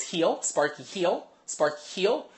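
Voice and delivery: nagging dog owner. Retold or imitated. Owner of a pulling dog commanding heel, insistent. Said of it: "then the owner goes".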